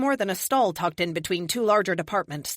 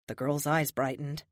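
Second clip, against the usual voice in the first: usual speaking voice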